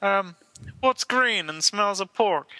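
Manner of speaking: high, slow frog puppet voice; Kermit the Frog. Acted. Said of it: "what's this joke then?".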